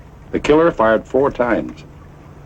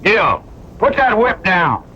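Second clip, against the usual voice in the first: high, slow, carrying command; raised voice telling Gil to drop the whip; calling out an order; 9 semitones higher, slower than usual. Acted. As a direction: call it out firmly across the yard.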